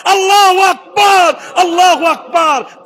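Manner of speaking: high, drawn-out shout of praise; acted full-voiced takbir shout; loud cry. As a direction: cry out as the startled mother. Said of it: "Amena জোরে চিৎকার দিয়ে বলছে".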